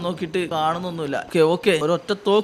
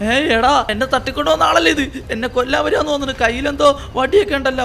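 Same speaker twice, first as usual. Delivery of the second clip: alarmed; 6 semitones higher, 6 dB louder than usual. Real, not acted. Kidnapper appears with stick.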